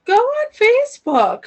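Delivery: high, far-off woman's voice giving an order; woman's inner voice. Retold or imitated. Inner woman's voice telling her to go on Facebook, high and eerie. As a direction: speak as a mysterious woman's voice in your head. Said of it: "It's a woman's voice".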